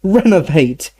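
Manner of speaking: high, urgent spell casting; urgent cry. A narrator's reading. Harry casting a reviving spell on Dumbledore, high urgent cry. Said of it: "he cried".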